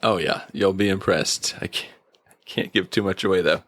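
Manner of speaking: ordinary delivery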